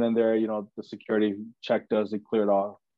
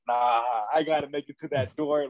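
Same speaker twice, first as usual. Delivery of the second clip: stubborn; 4 semitones higher than usual. Retold or imitated. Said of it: "the manhood".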